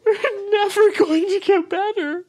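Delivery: high wailing sob; crying on the phone. Acted. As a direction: sob it out, falling apart. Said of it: "please don't cry".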